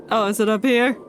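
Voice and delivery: ordinary delivery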